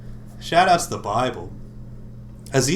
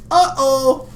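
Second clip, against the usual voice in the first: mock uh-oh; 13 semitones higher, 8 dB louder than usual. Mock alarm squeal.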